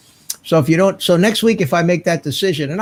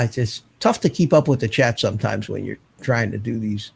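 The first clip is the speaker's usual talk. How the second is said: ordinary talk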